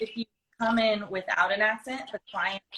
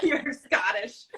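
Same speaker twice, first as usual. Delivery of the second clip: imitating Scottish accent; 2 semitones higher, slower than usual. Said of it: "Don't judge me".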